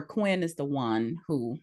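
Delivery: normal talking voice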